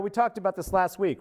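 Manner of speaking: normal talking voice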